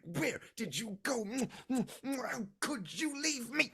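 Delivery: very high, overwrought mother's voice; frantic scolding mother. Acted. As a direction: scold and fuss over a lost child. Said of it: "boxing him around the ears".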